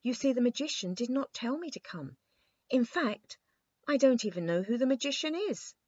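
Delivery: put-on a high little boy's voice; boyish king voice. A narrator's reading.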